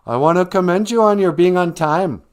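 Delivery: loud, high, smug school principal; mean principal. Retold or imitated. Principal greeting detention kids, smug and loud. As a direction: gloat over the kids like a pompous principal. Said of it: "the mean principal comes out, and he's like".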